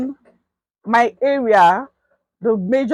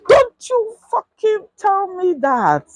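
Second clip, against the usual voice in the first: slow, much higher angry snarl; furious retort; 10 semitones higher, slower than usual. Retold or imitated. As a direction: hurl it back at her in rage. Man yelling at her.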